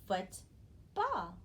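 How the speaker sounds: "football" with a clear pause between foot and ball; teacher demonstrating "football", foot, pause, ball; syllable blending demo. Shown as a demonstration. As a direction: sound out "football" as two separate syllables. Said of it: "slide my finger along the line and say".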